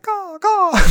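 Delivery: high loud cawing; crow caw. Shown as a demonstration. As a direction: caw like a big bird. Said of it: "one bird in the back".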